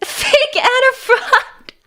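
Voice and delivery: high, loud and slow through laughter; mock accusation. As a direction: laughingly call herself out as a phony.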